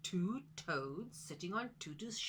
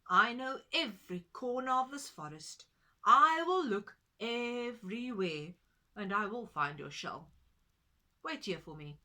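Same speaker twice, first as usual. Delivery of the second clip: Pigeon; 10 dB louder than usual. Acted. Pigeon promising to help.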